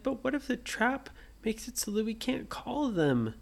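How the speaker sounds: put-on a small girl fretting about a trap; worried little girl. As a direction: ask it like a nervous little sister.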